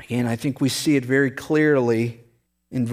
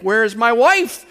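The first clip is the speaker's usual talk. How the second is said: higher and louder, half-hammed alarm; mock panic; 8 semitones higher, 4 dB louder than usual. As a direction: cry it out in comic panic. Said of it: "beginning to panic".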